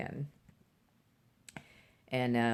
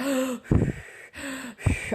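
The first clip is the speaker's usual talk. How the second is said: a high, panicked gasp for breath; sharp gasp for air; 12 semitones higher than usual. Retold or imitated. Panicking mom in a bathroom stall, gasping for air. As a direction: gasp like you can't get air in. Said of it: "I just needed these deep breaths".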